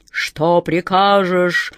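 slow, booming genie voice; thundering genie. A narrator's reading. Huge genie awaiting his master's command, slow and thundering. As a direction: boom it out slowly, each vowel drawn long. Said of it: "прогремел он".

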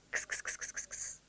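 whispered kss-kss calling a cat; kitty calling. Acted. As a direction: softly call a cat. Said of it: "позвала миссис Маккензи".

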